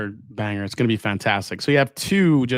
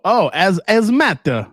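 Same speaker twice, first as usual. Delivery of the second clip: higher, louder, slow French "as, as matter"; French accent, silent H; 6 semitones higher, 5 dB louder, slower than usual. Shown as a demonstration. Host retrying hazmat in French, H-less mock French accent. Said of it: "French don't pronounce their H's".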